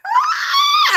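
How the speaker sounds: very high laughing scream; fangirl scream. Pretend. Fan screaming at seeing her idol, laughing scream. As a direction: let out a squealing scream. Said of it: "And I would scream".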